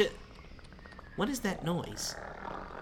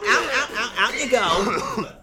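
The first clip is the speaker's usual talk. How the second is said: shouting; 4 semitones higher, 5 dB louder than usual. Acted. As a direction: bark him out of the room. Throwing someone out.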